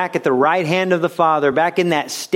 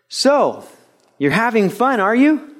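higher-pitched, teasing question; pointed questioning; 8 semitones higher than usual. Retold or imitated. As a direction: ask it high with a rising end. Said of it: "I hear this voice".

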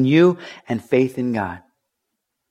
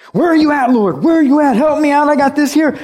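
much higher and louder, pleading yet stubborn; desperate cry to God; 13 semitones higher, 6 dB louder than usual. Retold or imitated. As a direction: shout up at the Lord, frantic and strained. Believer crying out to God.

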